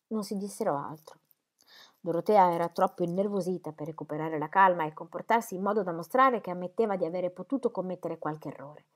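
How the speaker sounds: usual speaking voice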